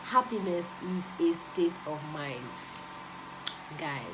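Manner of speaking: ordinary talk, nothing marked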